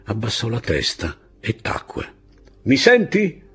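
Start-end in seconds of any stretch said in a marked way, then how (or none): 2.6-3.4 high, loud captain's yell; angry officer's loud demand; shouted question. A narrator's reading. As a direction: shout it at a silent subordinate. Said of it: "gli urlò il capitano".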